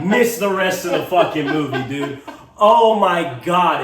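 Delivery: laughing hard through the words. Real, not acted. Telling a friend about missing the ending, laughing hard.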